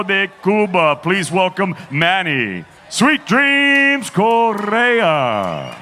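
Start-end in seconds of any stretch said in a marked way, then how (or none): 1.9-5.8 slow, stretched ring announcer call; drawn-out fighter introduction. Acted. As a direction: drag out the fighter's name.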